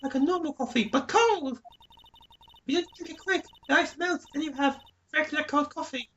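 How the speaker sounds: high put-on girly witness voice; shrill April May voice. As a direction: snap at everyone like a spoiled starlet. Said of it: "doing this voice".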